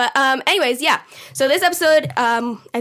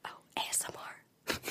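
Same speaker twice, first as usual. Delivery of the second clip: soft unvoiced ASMR whisper; 20 dB quieter than usual. Shown as a demonstration. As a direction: hush it like an ASMR video. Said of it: "they talk like this".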